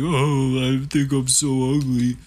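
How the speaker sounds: a lightly voiced self-pitying whine; mocking whine about looks; mock whiny friend. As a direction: mimic him fishing for pity. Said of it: "When you're like".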